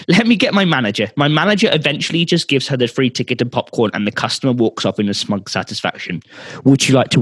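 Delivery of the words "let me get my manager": higher-pitched, mildly angry snap; exasperated, a touch angry; acted anger. Clerk giving up on the customer, mildly angry. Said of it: "I don't know why he's angry".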